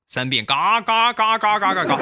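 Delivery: 嘎嘎嘎嘎嘎嘎嘎 yelled high and loud like a duck; 嘎嘎嘎嘎嘎嘎嘎 shouted high with a falling end; loud quacking shout. Shown as a demonstration. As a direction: quack the 嘎 syllables at full volume.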